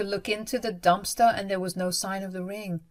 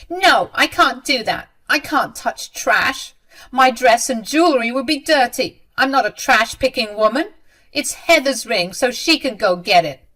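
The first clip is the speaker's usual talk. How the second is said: loud, higher-pitched protest; indignant refusal; 5 semitones higher, 7 dB louder than usual. A narrator's reading. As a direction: refuse as if it is beneath you.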